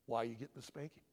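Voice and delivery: low, controlled parent voice; methodical. Acted. Dad questioning his child before a spanking, calm and methodical. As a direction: question the child calmly, without emotion. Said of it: "it's very methodical".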